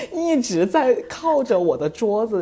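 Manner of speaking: laughing through the words, slowed; giggling while telling it, drawn out. Real, not acted. Telling a friend about the buzzing phone, laughing.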